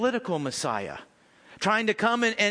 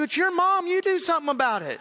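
mock retort; 9 semitones higher than usual. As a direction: throw the problem right back at them. Pastor's retort voiced in story.

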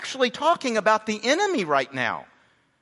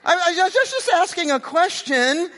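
whiny little brother; 11 semitones higher, 4 dB louder than usual. Acted. Preacher voicing David protesting he only asked a question. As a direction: plead innocence like a kid brother.

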